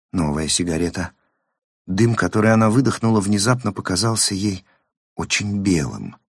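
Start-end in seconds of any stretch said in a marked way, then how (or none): none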